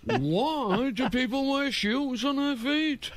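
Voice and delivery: high, sing-song comic voice; mock a comic's bouncy singsong delivery; high sing-song observational comic spoof; sing-song Seinfeld parody. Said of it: "going up on stage going".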